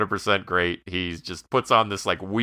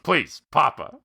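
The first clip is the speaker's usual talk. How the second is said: pitched-up mock insistence on Papa; mock Tony; 8 semitones higher, slower than usual. Host imitates Tony correcting the kids, playful. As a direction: insist on being called Papa. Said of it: "And he goes".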